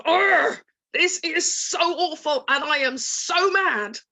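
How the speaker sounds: demo of being mad. Shown as a demonstration. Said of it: "feel that energy of".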